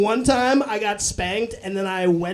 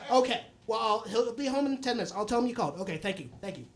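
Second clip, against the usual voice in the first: polite, softer, tapering to a quiet sign-off; mom's phone voice; 7 dB quieter, faster than usual. Acted. Mom taking a message, imitated soft phone politeness. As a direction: imitate mom courteously ending a call. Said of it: "mid-yell answer the phone".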